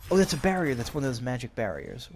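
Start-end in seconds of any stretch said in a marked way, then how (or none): 0.1-0.8 high, loud, pointing it out; suddenly noticing something; excited realization. Real, not acted. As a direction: call it out as you spot it. Noticing a magic barrier.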